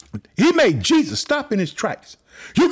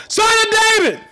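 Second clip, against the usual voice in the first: high, loud cry for mercy; shouted plea; 11 semitones higher, 4 dB louder than usual. Retold or imitated. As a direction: beg at the top of your voice. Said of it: "he shouted all the more".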